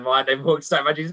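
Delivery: a quick bemused repeat; fast puzzled echo. Retold or imitated. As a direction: rattle his words back quickly, baffled. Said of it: "What, what do you mean, man?".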